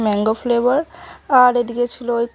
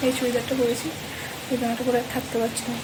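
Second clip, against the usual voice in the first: speaking softly; 6 dB quieter than usual. Real, not acted. Vlogger whispering distance from sleeping relatives, speaking softly. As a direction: keep it down so the sleepers aren't disturbed. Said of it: "একটু আস্তে আস্তে কথা বলছি".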